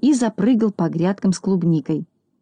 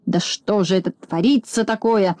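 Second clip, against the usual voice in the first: usual speaking voice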